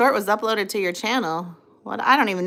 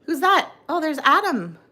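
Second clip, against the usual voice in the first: high, lilting mock voice of the commenter; high voice reading a comment; 8 semitones higher than usual. Streamer reads viewer's comment aloud, playful high voice. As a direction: read the comment out in a higher, playful voice. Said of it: "Mister Scary says".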